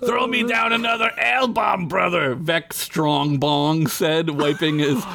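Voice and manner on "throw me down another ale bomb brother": high hearty dwarf shout; dwarf calling. Acted. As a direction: holler for more ale.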